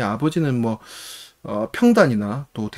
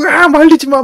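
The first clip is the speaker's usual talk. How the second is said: much higher and louder, out-of-control shout; wild yell; 12 semitones higher, 8 dB louder than usual. Retold or imitated. Calligrapher throwing shoes while being held back, frantic yell. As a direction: roar at whoever holds you back.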